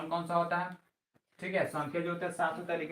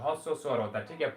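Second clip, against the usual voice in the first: ordinary talk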